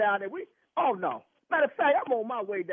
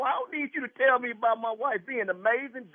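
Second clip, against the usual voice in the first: normal talking voice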